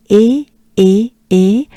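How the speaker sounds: E as a loud, separate French é; each E like the end of café; French E sound. Shown as a demonstration. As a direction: drill E firmly, no "ee". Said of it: "Think of café".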